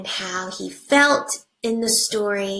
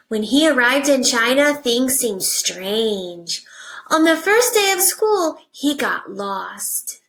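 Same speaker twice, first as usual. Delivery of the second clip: put-on reading to children; 3 semitones higher, 3 dB louder than usual. Teacher reading a picture book to her class, animated storybook voice.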